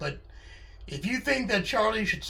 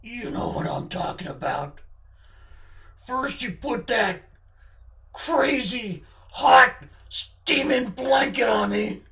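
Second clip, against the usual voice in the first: accusing character voice, building higher and louder; Charlie's menacing voice; 4 semitones higher, 4 dB louder than usual. Acted. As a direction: lay out a grievance with mock menace. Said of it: "that voice does sound menacing".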